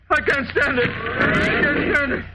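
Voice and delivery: hysterical cry. Acted. Cracking breakdown repeat.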